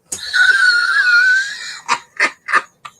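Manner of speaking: a loud, high burst of laughter. Real, not acted. Cracking up at his own pliers advice, loud high laugh. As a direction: let out a big shrieking laugh.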